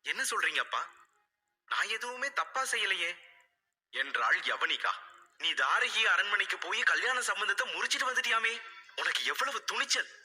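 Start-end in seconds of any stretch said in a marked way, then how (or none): 0.1-3.1 put-on higher young girl's voice, puzzled and hurt; bewildered daughter. A narrator's reading. Daughter confused by her father, higher pitched. 5.4-10.1 higher, fast reproachful mother; scolding mother. A narrator's reading. Mother scolding her daughter, higher and fast.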